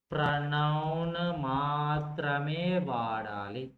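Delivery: slow, stretched vowels on every word; long, level, almost chanted syllables; drawn-out dictation. Shown as a demonstration. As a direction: dictate the rule as if others are writing it down.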